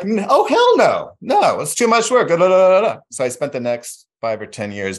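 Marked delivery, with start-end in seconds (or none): 0.0-3.0 high, loud mock refusal of the parents; mock parents refusing. Son asks for a puppy, parents refuse in a high mock voice. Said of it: "And they're like".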